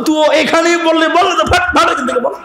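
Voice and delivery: exasperated high retort; impatient snap. Retold or imitated. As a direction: tell the kid to spit it out. Impatient with the boy.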